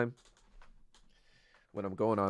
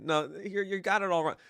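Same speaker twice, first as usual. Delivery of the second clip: ordinary talk, nothing marked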